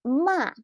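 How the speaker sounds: "Ma" high and loud, falling sharply; fourth tone. Shown as a demonstration. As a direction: drop "Ma" from high in one stroke. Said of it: "Last tone means to scold".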